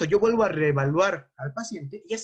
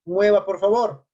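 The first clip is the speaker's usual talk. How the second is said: talking to patient; 2 semitones higher than usual. Shown as a demonstration. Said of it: "Yo pongo mi mano".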